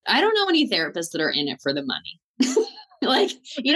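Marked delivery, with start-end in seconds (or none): 2.4-3.7 high laugh into the word. Real, not acted. Laughs at rich therapists.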